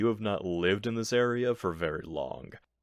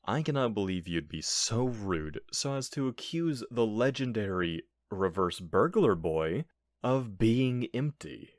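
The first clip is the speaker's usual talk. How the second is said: normal talking voice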